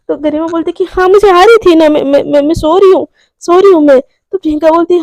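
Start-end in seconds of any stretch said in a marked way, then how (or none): 0.8-4.0 loud, stammered protest; flustered insistence. Retold or imitated. Garima telling Priyanka she is going to sleep, flustered insistence. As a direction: insist hastily, repeating yourself.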